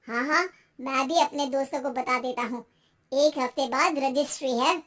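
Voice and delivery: low-pitched husband voice; pleased husband. Acted. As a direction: share good news as the man of the house.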